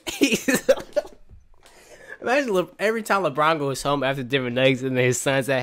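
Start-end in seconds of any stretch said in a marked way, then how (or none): 0.5-1.1 short high laugh; breaking up laughing. Real, not acted. Fan unable to finish his point, high wheezy laugh. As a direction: crack up before finishing the thought.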